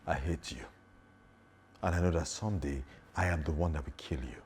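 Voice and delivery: low, hushed vow of hatred; intense threat. Shown as a demonstration. Actor redoes the threatening line for the lesson, low and quietly intense. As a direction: deliver the threat softly, held back and low. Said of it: "I'm gonna do it without looking at the camera".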